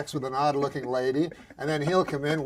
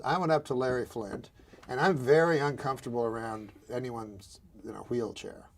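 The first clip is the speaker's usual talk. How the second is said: hushed confession; 7 semitones lower, 7 dB quieter, faster than usual. Real, not acted. Drops voice, confessional.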